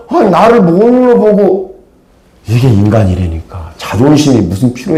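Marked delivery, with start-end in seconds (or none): 0.1-1.8 아 drawn out high, slow, offended; indignant Naaman. Retold or imitated. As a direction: huff it out slowly and high as the proud general. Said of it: "뭐라고 그래요?". (높고 느리게, 모욕당한 장군처럼)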